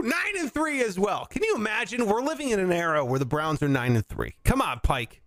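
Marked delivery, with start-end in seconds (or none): none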